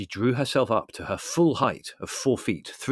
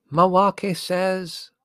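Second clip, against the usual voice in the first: high, loud, very drawn-out intoning; trance announcement; 10 semitones higher, 9 dB louder, much slower than usual. A narrator's reading. Mrs Haddock relaying her spirit guide, intoned announcement. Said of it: "announced Missus Haddock".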